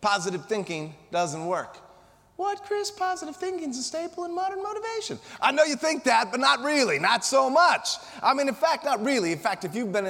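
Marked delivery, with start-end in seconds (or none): 2.4-5.2 high mock protest, a bit softer; sceptical audience member. Speaker voices an audience member objecting to him, in a high mock protest. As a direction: squeak out the objection like a doubter in the crowd.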